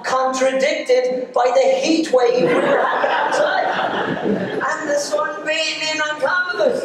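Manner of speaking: traumatized pupil. Retold or imitated. Nervous Johnny reading his forecast to Dad, high and traumatized. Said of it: "each word sounding a little more traumatized".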